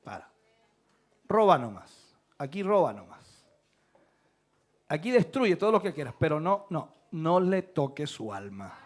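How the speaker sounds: orden serena, grave y pausada, levemente actuada; Dios poniendo límites. Acted. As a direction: pon el límite con autoridad tranquila, bajando la voz. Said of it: "pero él dice". (Dios poniendo límites, con voz grave y contenida)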